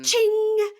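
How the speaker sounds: high sung chime sound; ding like a bell; playful chime effect; mock chime. Mock chime before Katerina's question.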